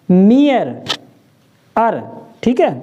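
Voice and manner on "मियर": ordinary talk, nothing marked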